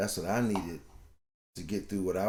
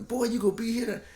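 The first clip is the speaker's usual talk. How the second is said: higher, sharp stern dad; rough father refusal; 5 dB louder than usual. Retold or imitated. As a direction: shut the boy down.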